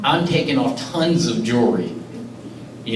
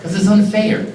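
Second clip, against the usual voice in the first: real anger; 9 semitones higher than usual. Real, not acted. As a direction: say it with a hurt edge. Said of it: "I'm just mad".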